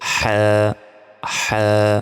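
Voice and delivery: হ্যাঁ pressed from the middle of the throat. Shown as a demonstration. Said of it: "গলায় চাপ দিয়ে". (গলায় চাপ দিয়ে হা)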